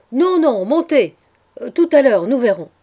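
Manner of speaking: high, loud overriding order; shouted insistence. A narrator's reading. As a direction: shout down objections, urging them up. Calling servants up from the yard.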